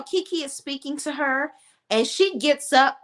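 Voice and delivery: ordinary delivery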